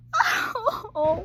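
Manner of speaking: high, loud tearful laugh; emotional laugh-cry. Real, not acted. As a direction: laugh through tears. Moved by the line.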